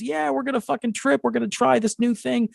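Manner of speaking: high-pitched mock party excitement; excited friends. Friends cheering on a new trip, excited. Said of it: "everybody's all excited".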